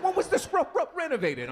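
high-pitched stammer on "renovated"; worsening stutter. Retold or imitated. Comic mimics the client's next question, stuttering high. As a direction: imitate a man catching repeatedly on the R. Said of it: "the worse the stutter gets".

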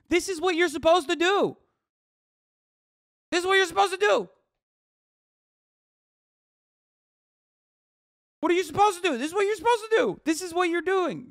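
very high, loud, insistent yelling; impassioned shouting. Real, not acted. Streamer yells what government is supposed to do, impassioned. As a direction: hammer the point home at full pitch.

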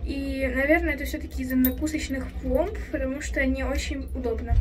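muffled behind surgical mask; speaking with covered mouth; through a face mask. Real, not acted. Seen 0: Mask covers mouth and nose in every labelled frame; R frames unmasked.

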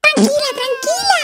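put-on high, alarmed pleas to a wailing baby; flustered soothing. Second baby starts crying.

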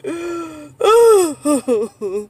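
long, wavering cry-like sigh; real overjoyed whimpering sigh; emotional cry-sigh. Real, not acted. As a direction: let out an overcome sob of a sigh. Overwhelmed with joy.

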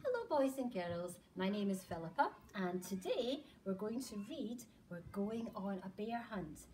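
plain reading voice

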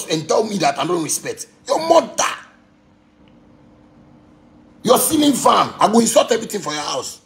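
high-pitched, indignant demo rant; angry insult tirade. Acted. Showing how he insults someone's house, heated high rant.

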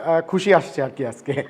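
ordinary talk

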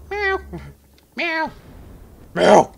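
high mock meows; cat meow. Imitating the cat.

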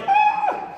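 short, very high cry; high-pitched storytelling "ah"; imitated cry. Storyteller acting out his cry as he runs, high yell.

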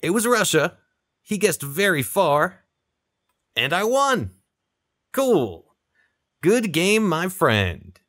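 pleased little victory lift; happy surprise at winning; excited win. Real, not acted. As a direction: pitch jumps up as he realises he won. Winning the final round.

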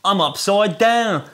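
high, loud hammed-up Australian voice; cartoonish Australian accent, pitched high; mock Australian accent. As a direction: ham up an Aussie bloke, upside down. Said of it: "a very Australian version".

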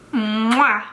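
normal talking voice

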